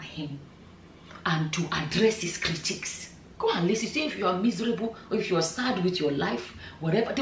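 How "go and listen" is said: usual speaking voice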